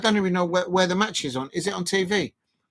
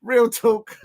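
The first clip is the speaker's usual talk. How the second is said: usual speaking voice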